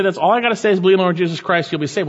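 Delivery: quick, offhand and dismissive; glib quote. Retold or imitated. Preacher mimicking people who reduce the gospel to a slogan, quick and glib. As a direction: rattle it off like a throwaway slogan. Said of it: "Sometimes people say".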